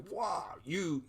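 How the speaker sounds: hammed-up indignant retort; mock confrontation. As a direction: fire it back at a colleague in mock outrage. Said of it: "coming at her".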